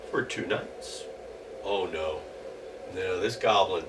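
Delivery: ordinary delivery, nothing marked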